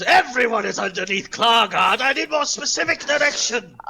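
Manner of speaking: loud, high grand declaration; boastful Clargard. Acted. Self-important warrior answering the hidden slime, boastful. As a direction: proclaim it loudly in the third person. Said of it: "Clargar goes".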